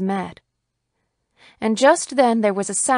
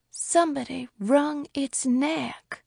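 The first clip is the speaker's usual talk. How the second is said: slow, hushed horror; slow, grave voice; 6 semitones higher, 4 dB quieter, slower than usual. A narrator's reading. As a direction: draw it out gravely, word by word. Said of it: "in a voice that was slow and deep".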